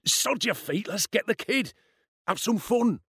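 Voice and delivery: high, impatient bully voice; glowering. A narrator's reading. Bully urges his mate to get the kid, glowering. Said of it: "Ian glowered back".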